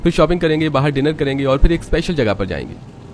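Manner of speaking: husband listing the surprise outing cheerfully; playful young husband. Acted. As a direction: tease your wife about the plan. Narrator voices Rohan.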